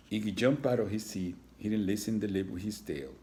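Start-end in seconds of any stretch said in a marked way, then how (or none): none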